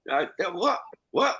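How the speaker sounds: ordinary talk, nothing marked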